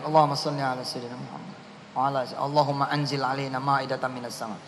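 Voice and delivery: fast, soft recitation of the prayer; murmured prayer. Acted. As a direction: rattle the prayer off under the breath.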